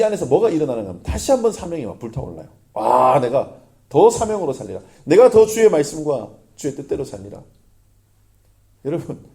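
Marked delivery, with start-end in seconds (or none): 2.7-7.4 loud, burning resolve; fervent vow. Retold or imitated. Pastor voicing his own renewed calling, loud and fervent. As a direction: declare it like a fired-up vow. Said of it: "하고 막 내 자신한테 화가 나면서".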